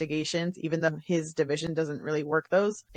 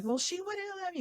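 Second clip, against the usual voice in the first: much higher, softer sheepish mother voice; mock meek mother; 13 semitones higher, 8 dB quieter than usual. Retelling a mom answering the officer, mock meek voice. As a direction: squeak it out like a caught mom.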